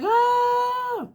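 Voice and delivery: high-pitched imitated scream of a hurt child; mock scream. Mother imitating her bitten daughter's scream. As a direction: squeal it out like the bitten little girl. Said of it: "She was like".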